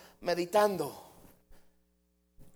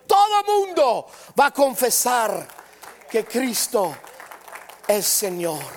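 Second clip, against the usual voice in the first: high, loud preaching that builds to a cry on Señor; fiery preaching; 6 semitones higher, 6 dB louder than usual. Acted. Preacher proclaiming to the church that every knee bows, loud and high. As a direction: preach it out, lifting the whole room.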